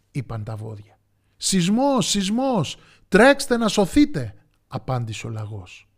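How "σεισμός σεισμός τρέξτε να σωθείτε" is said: high, loud cry of alarm; panicked storybook hare; alarmed warning. A narrator's reading. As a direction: shout a warning as you flee. Hare warns of earthquake.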